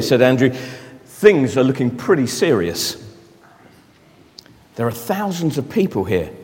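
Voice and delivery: soft, low storytelling voice; reading a story to children, soft and low; story narration. Acted. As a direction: draw the children in quietly.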